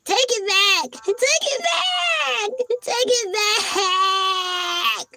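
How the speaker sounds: very high wailing, slowing into a cry; hammed-up tearful shout, dragging it out; mock sobbing. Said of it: "He's sobbing".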